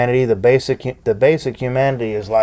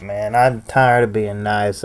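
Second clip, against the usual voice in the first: ordinary talk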